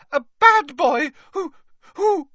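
high, loud, faltering squeak; angry squeaky elf. A narrator's reading. Elf struggling to denounce his master, squeaking high and loud. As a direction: squeak furiously and stick on the last word. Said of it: "squeaked Dobby angrily".

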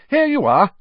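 high motherly voice for Mrs Weasley. A narrator's reading. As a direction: voice her as a brisk mum. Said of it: "she said".

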